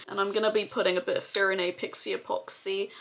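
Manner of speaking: ordinary delivery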